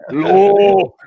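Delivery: loud, drawn-out Lowland Scottish; Lowland accent. Shown as a demonstration. Mimicking the Lowland accent for laughs, loud Lowland Scots. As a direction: belt it out in a mock Lowland accent. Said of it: "more harsh and low".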